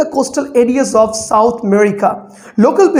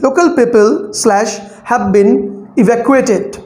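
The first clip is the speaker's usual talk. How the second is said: slow, with breaks after "Local people" and "have been"; scanning with breath breaks. Shown as a demonstration. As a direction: mark the breaks in "Local people slash have been evacuated" with clear pauses. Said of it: "I'm taking breath".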